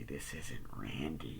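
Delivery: low, slow, soft menace; creepy caller. Retold or imitated. Killer on the phone correcting Sydney, slow and low. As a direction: let each word sink in darkly.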